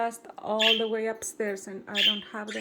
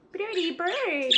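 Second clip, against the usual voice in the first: high, cooing pet voice, much higher; 9 semitones higher, slower than usual. Real, not acted. Owner cooing to her kicked-out bird, high baby talk.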